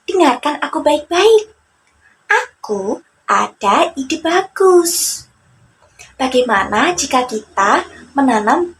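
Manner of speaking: cheeky trickster with a plan; Joni scheming. Acted. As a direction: pitch the clever idea slyly. Joni explains his plan.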